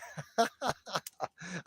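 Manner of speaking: high burst of laughter; amused laugh. Real, not acted. After singing badly for viewers, amused laugh. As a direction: laugh at your own bad singing.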